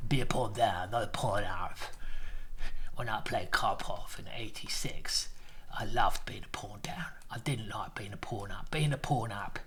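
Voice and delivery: put-on husky, half-whispered South London accent. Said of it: "in a South London accent".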